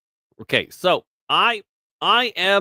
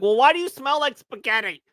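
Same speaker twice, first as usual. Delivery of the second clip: mocking question; 9 semitones higher than usual. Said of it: "I'm like".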